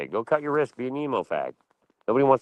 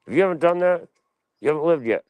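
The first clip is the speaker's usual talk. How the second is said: emphatic; 7 semitones higher, 4 dB louder than usual. Real, not acted. Emphatic lecture to a listener.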